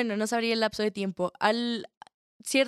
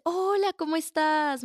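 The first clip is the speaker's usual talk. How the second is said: friendly caller; 7 semitones higher, slower than usual. Retold or imitated. Friendly caller quoted by agent.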